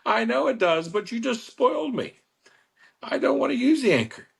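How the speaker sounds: hammed-up high whiny customer voice; imitating member. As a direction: play the spoiled club member, giggling and pleading.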